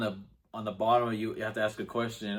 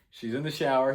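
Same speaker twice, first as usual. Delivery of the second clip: ordinary delivery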